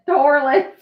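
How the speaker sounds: squealed through a laugh; laughing as she says the word. Real, not acted. Laughing at a word that cracks her up. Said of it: "Toilet gets me".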